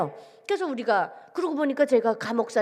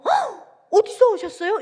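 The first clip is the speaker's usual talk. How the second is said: sharp high gasp, then a louder question; startled; 9 semitones higher, 5 dB louder, slower than usual. Retold or imitated. Restaurant server sees the ragged strangers, startled and high-pitched. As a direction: imitate someone taken aback. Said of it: "흠칫 놀라시면서".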